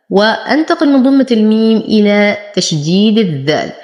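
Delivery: ordinary delivery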